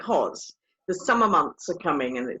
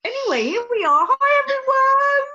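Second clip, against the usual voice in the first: big show greeting; 6 dB louder than usual. Pretend. Co-host greeting listeners to open the show, over-the-top cheery. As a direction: belt out a sing-song welcome.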